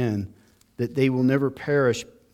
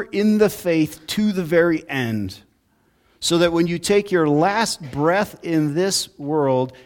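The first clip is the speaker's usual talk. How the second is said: full-force preaching; 5 semitones higher, 6 dB louder than usual. Acted. Pastor preaching to his congregation, building force. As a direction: drive the doctrine home to the congregation.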